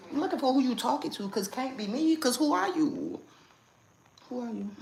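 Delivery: high-pitched, dismissive comeback; feigned confusion. Shown as a demonstration. Showing viewers what to say to strangers, feigned confusion. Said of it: "when they be confused".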